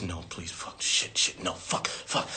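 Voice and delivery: whispered frantic cursing, almost no voice; breathless whispered dread; panicked whisper. Retold or imitated. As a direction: mutter in terror walking to the stage. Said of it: "I would leave my seat like this".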